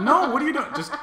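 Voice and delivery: high, loud alarmed cry; alarmed shout. Real, not acted. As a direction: shout in disbelief. Watching her fall.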